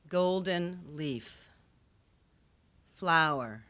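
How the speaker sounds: slow, measured poem recital with long pauses; poetry reading. Acted. Poet reading a poem on history to an audience, slow solemn recital. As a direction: read each phrase as its own weighted line, slowly.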